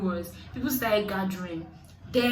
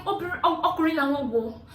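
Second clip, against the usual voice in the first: mocking the women; 6 semitones higher, 3 dB louder than usual. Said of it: "I'm like".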